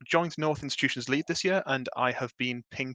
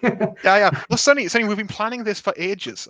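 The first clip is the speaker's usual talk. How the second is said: loud, high-pitched mock protest; playful protest; 7 semitones higher, 9 dB louder than usual. Real, not acted. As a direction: talk over the teasing, louder and higher. Playful protest at friend's teasing.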